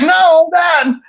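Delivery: high, drawn-out retold objection; excited protest. Man retells stopping his brother leaving, excited. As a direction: stretch it out high, half laughing.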